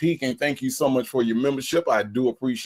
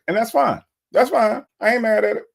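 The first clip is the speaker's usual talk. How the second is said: mock hurt; 11 semitones higher, 4 dB louder than usual. Streamer mock-hurt over singing criticism.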